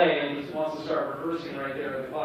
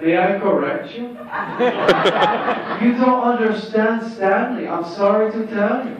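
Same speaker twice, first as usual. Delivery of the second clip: higher, louder, slow and deliberate correction; mock-polite lecture to the teacher, higher and slow; Tommy voice; 6 semitones higher, 8 dB louder than usual. Tommy correcting the teacher, in a higher, slower put-on voice.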